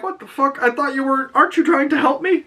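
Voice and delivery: put-on a boy outraged that his helper won't help; indignant kid. Egg indignant at Dunk, high mock kid voice.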